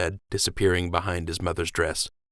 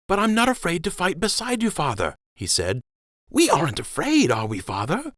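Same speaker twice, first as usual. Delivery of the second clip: put-on much higher child's voice, pleading; a boy begging his father to take him along; small prince, earnest and pleading; eager young prince; 10 semitones higher than usual.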